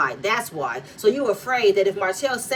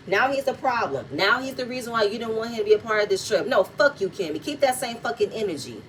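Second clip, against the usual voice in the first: normal talking voice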